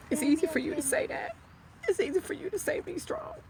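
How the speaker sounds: high tearful crack rising on each phrase; strained crying plea; voice breaking. Real, not acted. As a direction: push through tears as the pitch breaks upward. Grieving widow rebuts advice.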